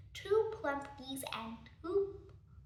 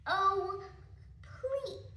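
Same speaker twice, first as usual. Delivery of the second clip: high, pleading please; sweetly beg, pitched up; very polite; 6 semitones higher than usual. Acted. Fox politely begging rat to move, high and sweet. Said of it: "very politely said".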